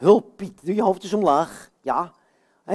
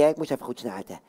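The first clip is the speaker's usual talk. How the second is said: usual speaking voice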